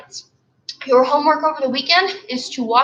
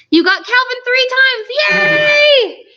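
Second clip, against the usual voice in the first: high, loud celebration ending in a shout; excited cheer; 11 semitones higher, 6 dB louder, slower than usual. Real, not acted. Cheering a comment from home.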